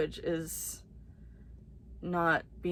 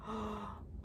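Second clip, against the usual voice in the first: sharp gasp; 3 semitones higher, 14 dB quieter than usual. Real, not acted. Reading blurb, sudden gasp.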